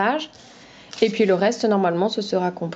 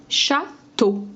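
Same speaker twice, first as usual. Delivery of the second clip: "château" broken into châ, then teau; syllable by syllable; 3 semitones higher than usual. Shown as a demonstration. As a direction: say "château" in two separate syllables, short pause between. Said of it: "Enlève la deuxième syllabe". (« château » découpé : châ, pause, teau)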